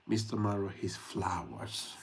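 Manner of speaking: ordinary talk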